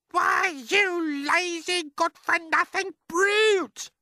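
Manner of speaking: high, loud, slow shrieking insult; furious shout. Acted. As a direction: scream at the lazy boy. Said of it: "Aunt Spiker shouted".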